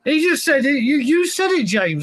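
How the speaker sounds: high-pitched indignant protest; excited protest. Real, not acted. Cohost protests his friend's big-head joke, excited and high-pitched. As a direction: jump up in pitch, laughing disbelief. Protesting James quoted him.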